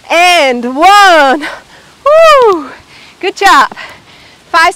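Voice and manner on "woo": loud high whoop; cheer. Real, not acted. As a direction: let out a joyful whoop. Cheering end of a set.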